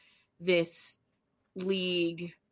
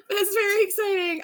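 near tears; 13 semitones higher, faster than usual. Real, not acted. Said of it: "now I'm gonna cry".

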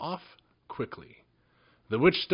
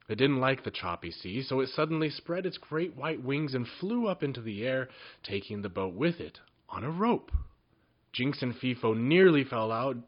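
plain reading voice

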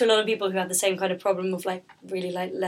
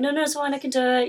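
higher, quick brush-off, lightly acted; mock-insistent self-quote; insistent refusal; 7 semitones higher, 5 dB louder, faster than usual. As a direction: wave away an offer of help. Mimics herself refusing help.

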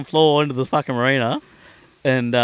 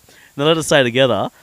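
normal talking voice

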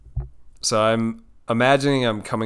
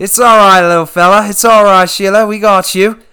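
loud Aussie wrangler soothing an animal; raised voice, reassuring the creature in broad Australian; Steve Irwin; 6 semitones higher, 9 dB louder than usual. Acted. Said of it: "I feel like I'm going British now".

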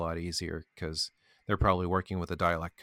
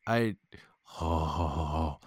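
ordinary talk, nothing marked

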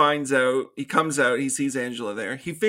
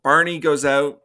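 usual speaking voice

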